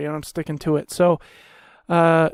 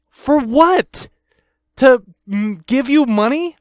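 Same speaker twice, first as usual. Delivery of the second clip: higher, louder, incredulous demand; exasperated, raised-voice challenge to the company; indignant outburst; 5 semitones higher, 4 dB louder, slower than usual. Acted. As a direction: fire back in outraged disbelief. Ranting at the insurer over extra fee.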